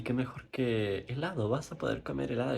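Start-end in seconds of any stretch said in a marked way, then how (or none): none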